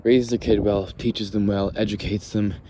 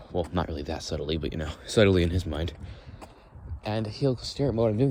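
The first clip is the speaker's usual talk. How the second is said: usual speaking voice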